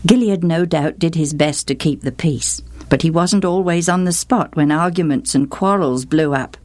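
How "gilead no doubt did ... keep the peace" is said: plain reading voice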